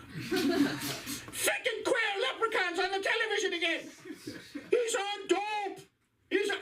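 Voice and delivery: high, slow indignant rant; angry Irish father. Retold or imitated. Father ranting at the leprechaun on television, high slow outrage. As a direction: rant at the telly like an old Irish dad. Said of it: "hear my father on the other side".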